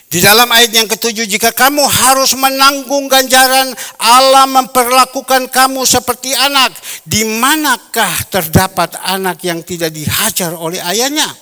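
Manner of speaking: fiery pulpit reading; preaching at full force. Acted. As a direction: proclaim the verse to the whole church. Loud scripture reading in sermon.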